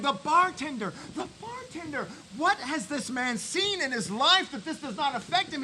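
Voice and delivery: ordinary talk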